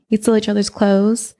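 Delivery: normal talking voice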